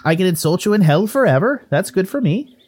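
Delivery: put-on Irish-Canadian lilt, miffed; huffy ghost Willie. Ghost brushed off by the adventurer, huffy. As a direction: take mild offence as a chatty ghost.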